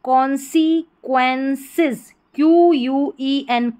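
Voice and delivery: slow, careful sounding out of the word; slow spelling out. Shown as a demonstration. Teacher sounding out a long word slowly for students. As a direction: stretch each syllable for the class. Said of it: "पूरी spelling क्या बन जाएगी".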